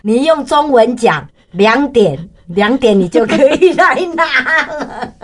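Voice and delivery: 笑着转述官员的话; amused officer. Retold or imitated. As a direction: deliver the officer's line with a chuckle. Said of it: "他说".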